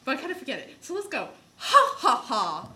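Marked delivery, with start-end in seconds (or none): 1.6-2.7 slow, stagy drama-club line; big deliberate laugh, then drawn-out words; Ghost of Christmas Present. Acted. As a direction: play a hearty stage ghost. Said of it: "here's one of my lines".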